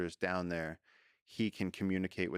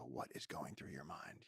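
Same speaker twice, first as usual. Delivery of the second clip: hushed, lower musing to an insect; mock private question, much softer; quiet wondering; 4 semitones lower, 13 dB quieter than usual. Man to praying mantis on his hand, quiet wondering.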